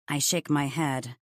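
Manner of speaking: ordinary talk, nothing marked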